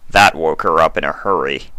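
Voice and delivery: plain reading voice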